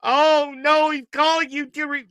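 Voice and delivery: high-pitched mock sobbing; exaggerated tearful imitation; mock crying. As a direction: whine it like a preacher in tears. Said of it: "This man's done crying all the time".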